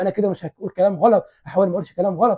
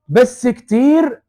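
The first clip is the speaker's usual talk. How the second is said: loud, very slow, higher "بس كتير"; drawn-out emphasis; 7 semitones higher, 9 dB louder, much slower than usual. Real, not acted. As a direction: stretch it out to shock the listeners. Dramatic turn in the sermon. (بس كتير بصوت عالي وبطيء جدًا)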